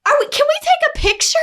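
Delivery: imitating a fan asking for a picture; eager fan. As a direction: ask for a photo like a thrilled fan. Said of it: "She's like".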